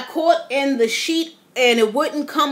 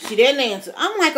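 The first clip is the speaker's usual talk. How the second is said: ordinary talk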